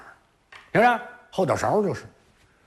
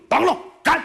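high, loud barked command; execution order; 14 semitones higher, 4 dB louder than usual. Acted. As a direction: shout the death order. (孙武拍案厉声下令斩首)

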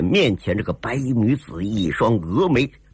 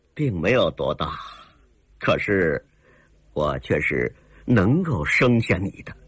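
put-on composed older woman; 7 semitones lower than usual. A narrator's reading. Woman calmly provokes the girl.

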